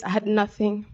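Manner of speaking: normal talking voice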